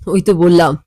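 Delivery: usual speaking voice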